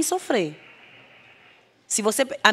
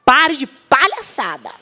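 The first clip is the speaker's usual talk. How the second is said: louder, slow stern scolding; stern mother's order; 3 semitones higher, 8 dB louder, much slower than usual. Retold or imitated. Mother ordering her crying daughter out of bed, loud and stern. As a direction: bark it like a strict mother. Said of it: "Porque a minha mãe falaria".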